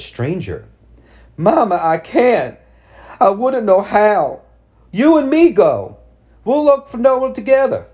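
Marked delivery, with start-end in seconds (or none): 1.4-7.9 high, loud, tearful pleading; crying. Acted. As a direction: cry like a lost child. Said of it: "I was crying like a lost child now".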